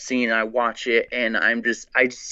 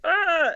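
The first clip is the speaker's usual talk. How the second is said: little high yelp of pretend fright; mock scream. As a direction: imitate a startled "ah!" up high. Mimics frightened guy fleeing the gun.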